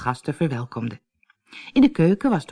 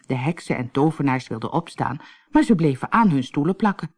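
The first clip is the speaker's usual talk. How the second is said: ordinary delivery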